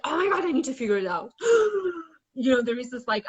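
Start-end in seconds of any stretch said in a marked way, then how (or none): 0.0-1.3 panicky rush. Retold or imitated. Panicked mind voiced in talk. 1.4-2.0 panicked gasp. Retold or imitated. Panicked mind voiced in talk.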